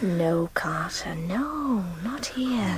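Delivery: whisper. Acted. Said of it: "whispered in bed".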